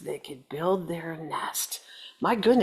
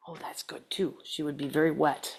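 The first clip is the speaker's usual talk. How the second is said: whispered aside; 6 semitones lower, 7 dB quieter than usual. Real, not acted. Relief aside.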